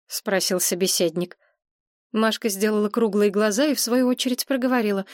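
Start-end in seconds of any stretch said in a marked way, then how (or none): none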